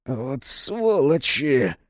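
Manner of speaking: slow wheezing curse; hoarse wheeze. Acted. Choked Serafim on the floor, slow hoarse wheeze. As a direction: squeeze it out hoarsely, slowly. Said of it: "просипел".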